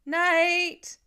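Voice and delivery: loud shout into the dark; searching father yelling; calling out. A narrator's reading. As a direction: shout his name, desperate. Father calling lost son.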